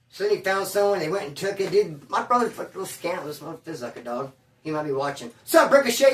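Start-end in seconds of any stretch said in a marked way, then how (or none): 5.5-6.1 high, loud hail; playful call-out. Pretend. Hailing his brother through the video, loud call-out. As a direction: call out to someone who may be watching. Calls out to brother maybe watching.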